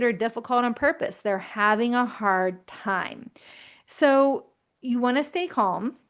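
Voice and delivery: ordinary talk, nothing marked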